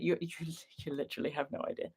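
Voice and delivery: normal talking voice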